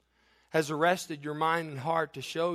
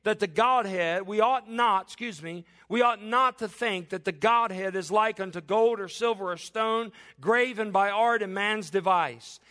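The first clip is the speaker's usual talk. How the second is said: higher, louder fervent sermon delivery; preaching at full force; 6 semitones higher, 3 dB louder than usual. Acted. Preacher exhorts the congregation in full, raised preaching voice. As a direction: preach it to the whole crowd.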